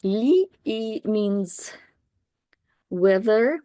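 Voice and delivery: normal talking voice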